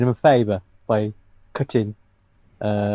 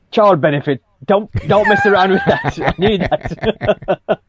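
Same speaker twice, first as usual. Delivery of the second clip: hammed-up whine over child benefit; mock greedy politician; 7 semitones higher, 3 dB louder, slower than usual. Mocking the chancellor, pleading to keep child benefit. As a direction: clutch the benefit like a greedy minister.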